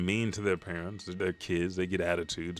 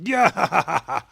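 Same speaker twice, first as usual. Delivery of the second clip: loud, high mock cackle; imitated villain laughter; 11 semitones higher, 9 dB louder than usual. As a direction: burst into a put-on gloating laugh.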